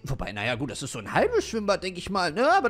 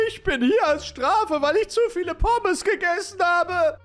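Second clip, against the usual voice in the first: higher, slow, louder whine; a sulky prisoner explaining himself; lightly voiced complaining man; whiny pool guy; 9 semitones higher, 4 dB louder, slower than usual.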